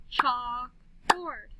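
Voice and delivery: CHALKBOARD slowly as chalk, board; slow "Chalk-board" for clapping; clapped syllables. Shown as a demonstration. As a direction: split "Chalkboard" into two long beats. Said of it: "then we'll clap the syllables".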